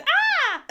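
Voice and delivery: high loud playful shriek; retelling her fake scream to excite the dog; mock scream. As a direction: shriek like you're startling the puppy. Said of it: "mommy screaming".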